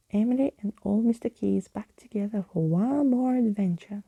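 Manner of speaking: ordinary delivery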